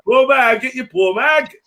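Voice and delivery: high-pitched vendor's cry; mock street seller. Hawking the poverty magazine to passers-by, high street cry. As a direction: call out your wares in a high voice.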